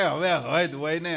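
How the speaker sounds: a hint of someone fed up; mild mock exasperation. A devotee grumbles that enough is enough, mildly exasperated. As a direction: sigh out a light complaint.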